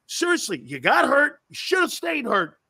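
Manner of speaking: ordinary delivery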